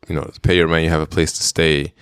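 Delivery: ordinary talk, nothing marked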